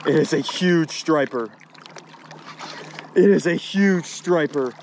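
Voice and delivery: thrilled yell at the fish he just landed; excited shout. Real, not acted. Angler shouting to his viewers about a huge catch, excited and loud. Landing surprise big fish.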